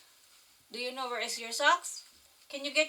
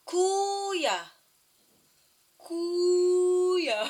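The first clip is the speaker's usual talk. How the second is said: "Kuya" called out higher and louder, a drawn-out fall; 7 semitones higher, 6 dB louder than usual. Shown as a demonstration. Mother showing her toddler how to say "Kuya" louder, calling out. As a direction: belt out "Kuya" so the toddler copies it.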